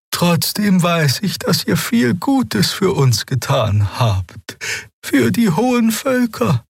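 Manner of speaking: high, reverent and slow; awed young healer. A narrator's reading. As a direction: speak as if meeting a legend. Xea speaks earnestly.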